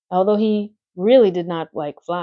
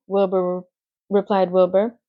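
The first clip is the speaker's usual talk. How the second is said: ordinary delivery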